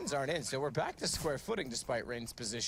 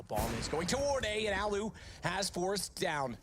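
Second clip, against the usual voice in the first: esports caster; 6 semitones higher than usual. Real, not acted. Bomb rotation play-by-play.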